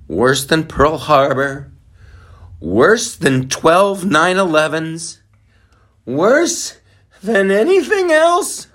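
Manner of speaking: slow, climbing to a high loud cry; mock outrage building. Host hamming up the worst day ever, building excited.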